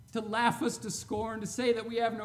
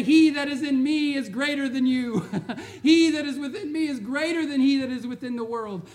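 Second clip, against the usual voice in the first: bold declaration; 5 semitones higher, 9 dB louder than usual. Pretend. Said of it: "You say".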